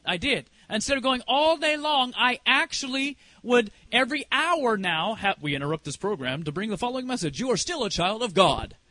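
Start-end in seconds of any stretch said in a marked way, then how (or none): 5.4-8.8 broadcast announcer. Acted. Hourly watch reminder interrupting the day, mock announcer. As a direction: lower announcer voice, brisk then steadier.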